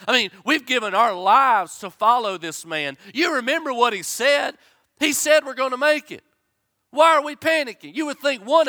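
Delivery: loud, high fervent preaching; preaching at full force. Acted. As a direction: preach it out with rising fire. Preaching peak again.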